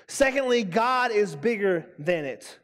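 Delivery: ordinary talk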